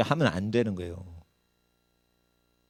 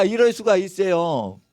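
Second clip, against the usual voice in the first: ordinary delivery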